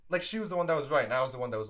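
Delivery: ordinary delivery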